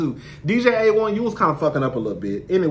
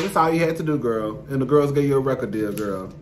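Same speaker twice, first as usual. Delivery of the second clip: ordinary delivery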